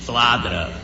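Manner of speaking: a haunting voice in her head; accusing conscience. Acted. Conscience accusing the mother, low distant hiss. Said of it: "A consciência dela gritava para ela".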